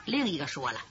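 plain reading voice

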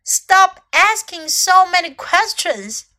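high, loud, slow telling-off; scold, dragging out each word; scolding chimp. A narrator's reading.

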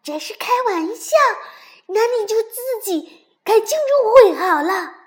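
high, angry shouting; loud and cross. A narrator's reading. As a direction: yell indignantly that the fun is spoilt. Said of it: "蹦蹦大声地说". (生气地高声嚷)

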